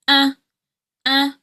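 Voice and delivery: "uh" short, slack and careless; lazy schwa. Shown as a demonstration. Coach repeats the schwa, like you don't care. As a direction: toss off each "uh" separately. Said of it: "like you don't care".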